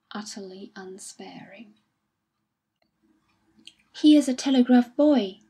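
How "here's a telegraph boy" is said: sudden, much louder call, higher pitch; sudden exclamation. A narrator's reading. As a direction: blurt it out as the boy appears. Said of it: "said Colonel Parsons suddenly".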